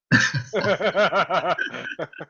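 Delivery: loud hearty laugh; real laugh. Real, not acted. Host laughs at accent joke.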